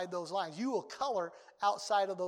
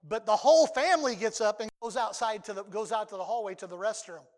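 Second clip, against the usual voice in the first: ordinary talk, nothing marked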